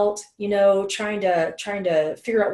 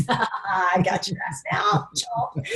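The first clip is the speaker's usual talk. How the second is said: gloating villain. Retold or imitated. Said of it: "the law of attraction goes".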